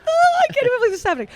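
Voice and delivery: very high, fast, loud gush; hammed-up thrilled disbelief; starstruck squeal. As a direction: squeal it in a rush, overwhelmed. Retold starstruck reaction.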